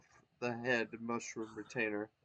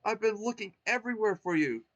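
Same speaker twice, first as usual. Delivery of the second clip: higher, louder eager call; excitedly; 11 semitones higher, 9 dB louder than usual. Acted. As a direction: call out with relief. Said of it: "he said excitedly".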